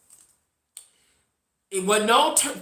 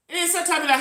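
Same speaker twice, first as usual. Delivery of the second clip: normal talking voice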